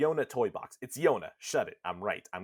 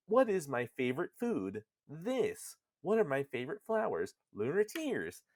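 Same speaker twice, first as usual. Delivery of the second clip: put-on higher-pitched little girl's quiz voice; lightly voiced child, pitched up and lilting; Yona; 5 semitones higher than usual. Daughter asking questions in Nier's mind, high and lilting. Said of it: "She's like".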